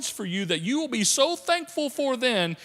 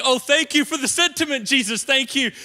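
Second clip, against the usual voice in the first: higher, mock-polite thank you; sarcastic thanks; 4 semitones higher, 4 dB louder than usual. Martha to Jesus, arriving too late, sarcastic. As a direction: thank him with a sarcastic edge. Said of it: "this is Martha's way of saying".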